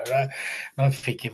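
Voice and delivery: normal talking voice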